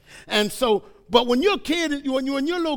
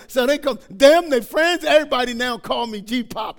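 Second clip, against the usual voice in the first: ordinary talk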